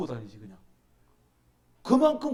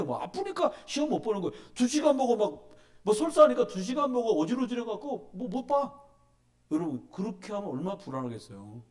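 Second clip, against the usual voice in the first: normal talking voice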